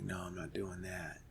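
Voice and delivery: soft unvoiced whisper saying no; whispered refusal. Refusing the Antabuse drug, whispered quietly. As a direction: breathe the refusal under your breath. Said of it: "And I was like".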